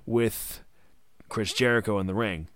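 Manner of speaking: ordinary delivery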